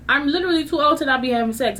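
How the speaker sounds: put-on rapid-fire "I'm literally too old"; fast, exasperated repeat. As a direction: rattle it off quickly. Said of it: "my favorite thing to say is".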